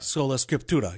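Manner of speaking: Sola Scriptura with a light Latin-Italian accent; put-on Latin accent. Shown as a demonstration. Co-host echoing the Latin term, in a put-on Latin accent. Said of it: "you keep putting your accent on it".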